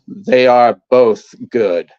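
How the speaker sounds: slow, deliberate mock reply; imitated odd customer. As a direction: space the words out slowly, imitating him. Acts out odd customer's deadpan reply.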